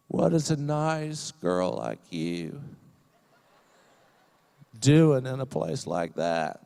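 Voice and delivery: slow, drawn-out presidential impression; Bill Clinton. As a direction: stretch it out with long, teasing pauses. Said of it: "He would say".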